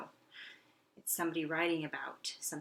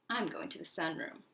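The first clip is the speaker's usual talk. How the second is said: usual speaking voice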